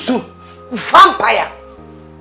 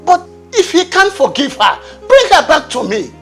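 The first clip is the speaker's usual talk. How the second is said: high, loud indignant demand; enraged father demanding his daughter back; outraged; 6 semitones higher, 4 dB louder than usual. Acted. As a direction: demand answers, rising in fury.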